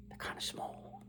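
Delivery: whispered aside; hushed whispered aside. Real, not acted. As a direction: breathe it out as a secret.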